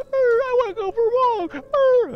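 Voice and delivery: very high, loud barking-dog voice; doggy voice. Retold or imitated. Retelling her dog begging to go for a walk, very high and loud. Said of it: "then the doggy was".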